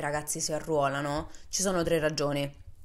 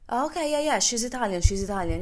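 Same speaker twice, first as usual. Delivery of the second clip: high, relieved American; a hint of a relieved soldier; relieved roommate; 7 semitones higher than usual. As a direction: let the tension drop with an easy yeah. Nate reassured by the passport.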